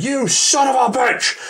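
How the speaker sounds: high-pitched mock-furious outburst; retold yell. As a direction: imitate a man suddenly yelling an insult. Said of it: "he just yells at me".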